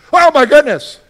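hammed-up alarm at the burnt muffins; panicked exclamation. Pastor acting his own kitchen panic, loud high cry. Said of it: "this is how [name] reacts".

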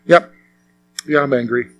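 soft, resigned admission; a hushed, deflated confession; quiet admission. Retold or imitated. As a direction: concede it under your breath. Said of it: "you're like".

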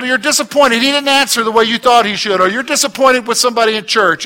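normal talking voice

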